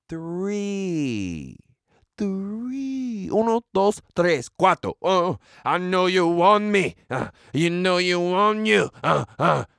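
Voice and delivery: stretched count into party-rapper ad-libs. Acted. Mocking three interceptions as a rapper, playful impression. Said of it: "That was my [name] impression".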